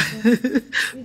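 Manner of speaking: bright burst of laughter; laughing. Real, not acted. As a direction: laugh with delight at the greeting. Laughing at thanks. (éclat de rire joyeux)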